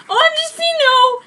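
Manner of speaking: very high, loud hammed-up cry; mock loud wail. Narrator told of transfer, very high loud mock wail. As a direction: shriek it in fake despair. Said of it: "said loudly".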